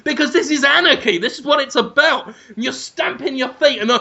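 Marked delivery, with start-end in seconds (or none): none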